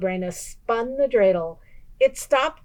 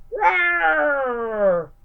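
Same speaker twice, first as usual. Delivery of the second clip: roar; 8 semitones higher, 3 dB louder than usual. A narrator's reading. Bear answers "eat like a bear.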